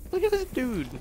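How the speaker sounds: put-on, very high squeaky repeat; high-pitched voice.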